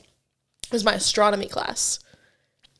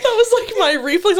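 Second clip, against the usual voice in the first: laughing hysterically; 10 semitones higher, 4 dB louder, slower than usual. Real, not acted. Laughing at own barking story.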